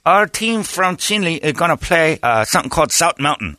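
imitated Navajo basketball player; Navajo accent. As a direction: announce the game in a mock Navajo accent. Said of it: "That's how the Navajo basketball".

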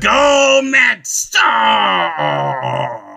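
high shouted coach voice; mock coach shouting at a crowd; Coach Steve. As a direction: yell it like a frantic coach on the sideline. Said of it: "I'm Coach Steve".

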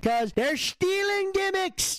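high, drawn-out outraged complaint; pissed off. Real, not acted. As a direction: wail it slowly in protest. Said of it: "I'm too pissed off".

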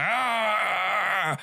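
long, high mock wail; mock scream. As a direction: let out a drawn-out, high, hammed-up cry. Imitating Antichrist's cry, repeated.

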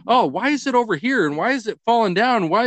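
put-on high, whiny child's voice asking why; imitating a little girl. Dad voicing his daughter finding the fallen elf, high and whiny. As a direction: play the puzzled little daughter. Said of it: "And she's like".

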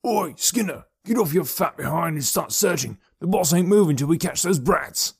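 high, rough-mannered henchman calling out; bossy call to a slacking mate; distant shout. A narrator's reading. Gang member orders Skinner up, distant shout. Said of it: "they heard another shout".

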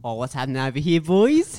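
hammed-up Australian cop, rising high on the last word; clumsy, broad Australian accent; like an American attempting an Australian accent. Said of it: "That was heaps more Australian".

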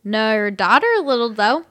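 put-on sloppy, slurring drunk man. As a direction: whine like a drunk guy. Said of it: "I'll just do my drunk voice".

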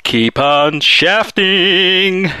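milk the sign-off phrase; drawn-out catchphrase. Acted. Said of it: "I should end in my usual catchphrase".

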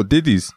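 "diddies" with D sounds in place of T's; "diddies", D for every T; T's as D's. Shown as a demonstration. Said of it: "replace T's with D's".

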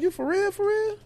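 can't believe the offer; disbelief. Retold or imitated.

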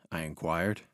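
ordinary delivery, nothing marked

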